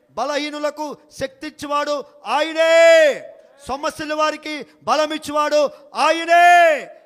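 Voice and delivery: loud shouted preaching, holding the last word long; preaching at full force. Acted. Pastor shouting God's power to congregation, full force preaching. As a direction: thunder it out like a revival preacher.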